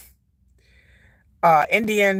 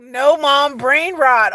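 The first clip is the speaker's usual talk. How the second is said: louder, raised-pitch mock protest; imitating exasperated kids; 5 semitones higher, 5 dB louder, much slower than usual. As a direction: protest like a kid mortified by mom. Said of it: "my kids lose their minds".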